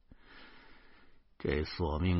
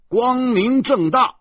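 defiant shout; 8 dB louder, slower than usual. Acted. Shouting bravely while walking.

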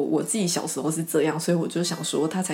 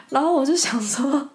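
ordinary talk

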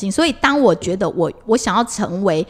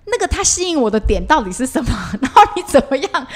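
laughing; 5 semitones higher than usual. Real, not acted. Laughing at her own confusion.

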